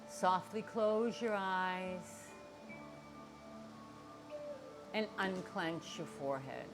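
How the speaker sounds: guided meditation. Acted. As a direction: ease them into calm, quietly. Guides relaxation before breathing.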